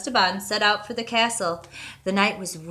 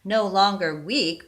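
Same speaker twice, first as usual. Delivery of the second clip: usual speaking voice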